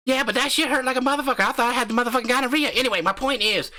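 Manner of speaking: brash country husband hyping up his channel; cocky backwoods YouTuber swaggering through the intro; crude hillbilly husband. Acted. Husband argues back.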